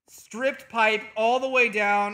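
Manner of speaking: loud, slow exaggerated gripe; exasperated complaint. Real, not acted. Streamer to fan over a sore throat, exasperated. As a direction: drag it out loudly in protest.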